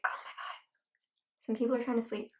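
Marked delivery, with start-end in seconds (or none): 0.0-0.6 unvoiced, hushed exasperation; whispering. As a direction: whisper it in annoyance. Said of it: "I was like".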